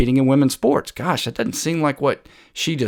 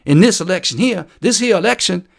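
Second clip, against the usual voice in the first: mocking Southern accent; 6 semitones higher than usual. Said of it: "nobody talks that way".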